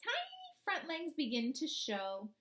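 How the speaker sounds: put-on slow, expressive read-aloud for young children; storybook reading. Teacher reading a frog book to her class, storybook voice.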